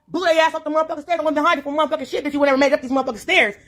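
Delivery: ordinary talk, nothing marked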